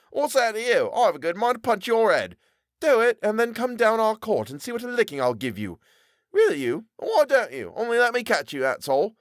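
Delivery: picking a street fight; quarrelling boy. Acted. Two boys threatening each other, taunting.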